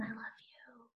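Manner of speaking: barely-there whispered love; faint whisper. Shown as a demonstration. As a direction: whisper it as softly as possible. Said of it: "You have to really listen".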